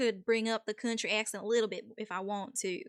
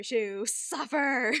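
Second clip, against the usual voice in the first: slow, louder theatrical suffer; drawn-out mock suffering; 3 semitones higher, 7 dB louder, much slower than usual. Streamer mocks a too-sweet song, hammed-up slow moan. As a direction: stretch out suffer slowly, hammed-up.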